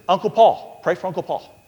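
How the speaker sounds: loud, high call of a name; mock calling out to the congregation. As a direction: call out the name across the room. Preacher retells calling out name.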